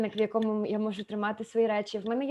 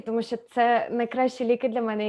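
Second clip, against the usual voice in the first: usual speaking voice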